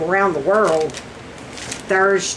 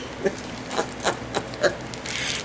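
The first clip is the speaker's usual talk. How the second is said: long, soft laugh; real voiceless giggle; 9 dB quieter than usual. Real, not acted. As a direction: keep giggling at your own joke. Laughs at her own bad joke.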